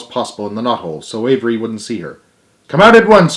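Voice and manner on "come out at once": high, loud stern order; crying out sharply. A narrator's reading. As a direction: call the boy out firmly. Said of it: "cried Missus Arabelle".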